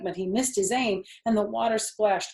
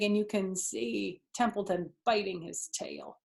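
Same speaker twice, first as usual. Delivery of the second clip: plain reading voice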